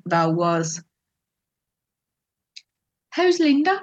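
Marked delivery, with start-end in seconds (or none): 3.1-3.8 pitched-up How's Linda; high surprised question. Retold or imitated. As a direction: ask it high and bright. Father's wrong-name question.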